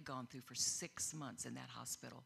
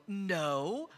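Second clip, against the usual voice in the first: retold refusal; 3 semitones higher, 9 dB louder than usual. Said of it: "And I would go".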